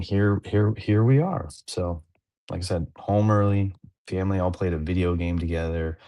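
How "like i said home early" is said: ordinary delivery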